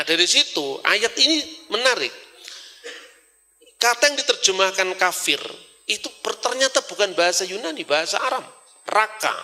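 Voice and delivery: ordinary talk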